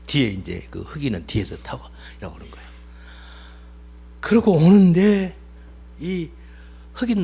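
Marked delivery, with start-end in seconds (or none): 0.1-2.6 dramatic whisper. Real, not acted. Preacher tells the cart ride.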